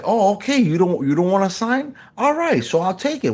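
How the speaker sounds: lightly acted, high-pitched feigned acceptance; hint of a high-voiced prankster courier; mock delivery man. Acts out fake delivery man.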